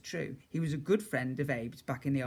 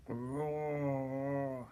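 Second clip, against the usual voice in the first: dragon moan; 4 dB quieter than usual. A narrator's reading. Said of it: "he moaned".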